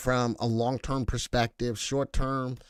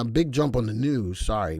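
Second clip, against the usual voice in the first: usual speaking voice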